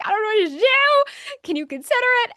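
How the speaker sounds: high, tearful pleading; crying plea. Retold or imitated. Speaker begging editor to publish the story, high crying plea. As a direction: beg for help through tears. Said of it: "And I'm in tears".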